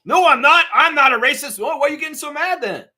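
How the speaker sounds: high, loud indignant yelp; a hammed-up outraged denial; mock angry denial. Acting a guilty person's outburst.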